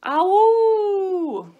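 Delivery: long, high, loud "ow" howl; pained wolf howl. A narrator's reading. Two wolves stung by bees, howling. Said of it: "howled Little Wolf and Smells".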